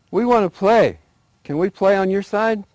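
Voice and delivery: high, loud small pleading voice; tiny mouse voice. Acted. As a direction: squeak it eagerly. Little mice voiced.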